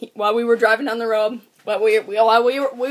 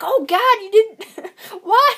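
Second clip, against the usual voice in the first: high, loud laughing disbelief; shocked cousin; 10 semitones higher, 8 dB louder, slower than usual. Retold or imitated. Cousin reacting in disbelief to the yelling, high and loud. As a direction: squeal it like you can't believe it.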